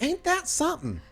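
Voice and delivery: very high, put-on gossipy voice; chatty nurse Susie. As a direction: gush it up high like a chatty receptionist.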